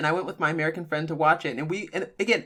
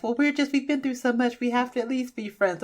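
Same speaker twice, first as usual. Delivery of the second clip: mock whiny, pitched higher; mocking ex's excuse; 8 semitones higher than usual. To her friend, mocking what exes say, sneering higher voice. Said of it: "I hear people do that B S".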